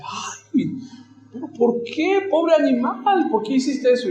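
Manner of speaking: high-pitched protesting wife; esposa consternada. Acted. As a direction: play an upset bride pleading for the animal. La esposa reclama al ranchero. (esposa que reclama con voz aguda)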